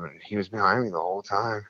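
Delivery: ordinary talk, nothing marked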